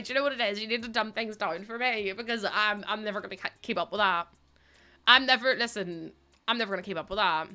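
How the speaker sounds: ordinary talk, nothing marked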